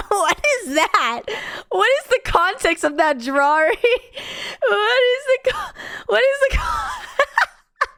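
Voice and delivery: breaking into laughter mid-question; can't get the words out for laughing. Real, not acted. Streamer cracking up at the Care Bear drawing.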